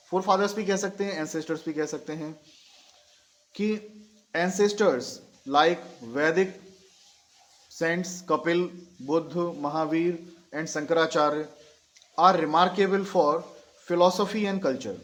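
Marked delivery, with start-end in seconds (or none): 4.3-15.0 slow English, broken into short phrases; slow dictation. Shown as a demonstration. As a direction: dictate a few words at a time. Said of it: "धीरे धीरे बोल रहा हूँ". (धीरे-धीरे, टुकड़ों में लिखवाते हुए)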